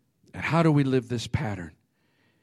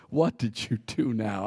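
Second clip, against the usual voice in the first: put-on God sighing at kids; 6 semitones lower, 8 dB quieter than usual. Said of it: "I wonder if God just like".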